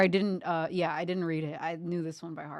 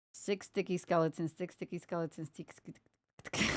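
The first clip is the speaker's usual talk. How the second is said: fast tongue twister; 3 dB quieter than usual. Shown as a demonstration. Streamer racing a tongue twister three times, fast. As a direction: rattle it off quickly. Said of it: "three times fast".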